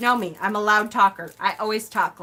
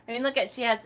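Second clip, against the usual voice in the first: ordinary delivery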